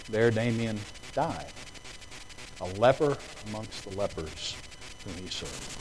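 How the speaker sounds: ordinary talk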